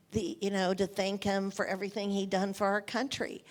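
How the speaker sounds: ordinary delivery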